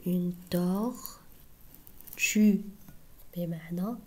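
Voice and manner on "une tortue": "Une tortue" said very slowly, each syllable drawn out; slow teaching demo. Shown as a demonstration. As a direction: stretch "Une tortue" slowly for children to copy. ("Une tortue" ببطء شديد مع مد كل مقطع)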